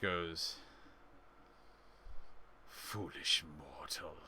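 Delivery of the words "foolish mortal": low, soft, slow otherworldly voice; ominous entity. Acted. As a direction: speak like an ancient being, hushed and low.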